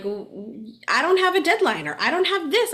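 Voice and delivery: a hammed-up huffy snap at coworkers; imagined blaming reply, indignant and high; mock snappy reaction. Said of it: "Or I could have been like".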